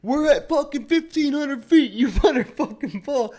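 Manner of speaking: high, loud, laughing yell; yelling buddy. Retold or imitated. Buddy shouting at him to pull, high and loud. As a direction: shout a frantic warning. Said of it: "buddy yelling mid-air".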